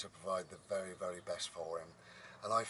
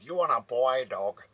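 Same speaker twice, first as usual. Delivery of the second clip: loud, higher-pitched mock farmer; exaggerated farmer; 4 semitones higher, 13 dB louder than usual. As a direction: ham up a farmer's question, loudly. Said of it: "He didn't even speak like that".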